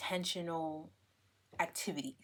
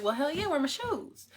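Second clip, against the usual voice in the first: eager; 9 semitones higher, 5 dB louder than usual. Retold or imitated. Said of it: "I'm like".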